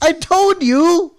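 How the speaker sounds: high, loud, slow I-told-you-so; gloating shout. Real, not acted. Gloating to a co-host who praised Solo, a loud slow crow. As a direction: crow it at your co-host, drawn out.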